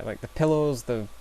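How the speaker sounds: ordinary delivery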